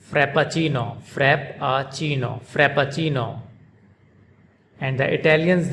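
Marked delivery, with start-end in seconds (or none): 0.1-3.4 frappuccino said the American way, single short p and a schwa: frap-a-chino; American frappuccino, light p, middle vowel an unstressed uh; American pronunciation. Shown as a demonstration. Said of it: "it's pronounced by Americans".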